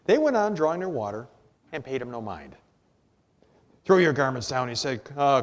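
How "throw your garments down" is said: louder, indignant order from a story donkey; cross. A narrator's reading. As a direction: order them about crossly.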